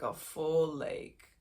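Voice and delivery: ordinary talk, nothing marked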